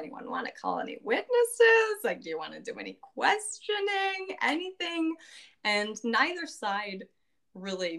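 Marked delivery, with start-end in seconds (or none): 0.0-5.2 high, coaxing judge voice; eager judge. Retold or imitated. Judge coaxing the crowd-pleasing witnesses, high and eager. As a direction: judge egging on the parties, pitched high.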